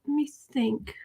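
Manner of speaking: a soft unvoiced aside while pondering; whispered aside. Real, not acted. Streamer whispering to herself while pondering, unvoiced. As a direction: let me think, breathed to herself.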